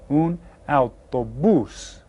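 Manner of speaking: slow "Un autobús" with the stress on "bús"; stress on last syllable. Shown as a demonstration. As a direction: model "Un autobús", hitting "bús". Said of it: "last syllable is stressed".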